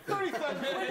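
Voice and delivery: outraged squeal at the sum; incredulous. Acted. Comedian repeats the translator's pay in laughing disbelief.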